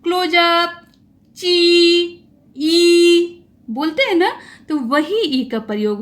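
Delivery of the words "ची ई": ची ई stretched into a long, higher, louder "cheeeese" as for a camera; teacher showing the camera "cheese" for ई; photo cheese demo. Shown as a demonstration. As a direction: grin and say ची ई like posing for a photo. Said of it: "जैसे बोलते नहीं है photo खींचते वक्त".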